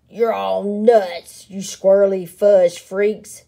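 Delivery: a blustering bully's yell. Acted. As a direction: yell insults and storm off. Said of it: "yelled Big Bark".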